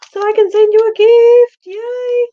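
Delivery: high, loud excited squeal. Real, not acted. To a viewer on the live feed, squealing with delight. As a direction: squeal the gift news with delight.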